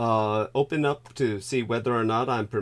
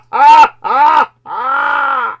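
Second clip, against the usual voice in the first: loud high laughing shriek; 13 semitones higher, 14 dB louder than usual. Real, not acted. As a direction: let a high scream of laughter out.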